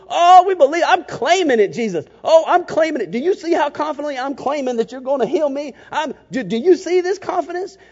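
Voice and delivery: high, loud, hammed-up faith claim, far above the plain voice; mock overconfident claiming. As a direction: ham up a believer forcing the miracle by sheer confidence. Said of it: "They're not going".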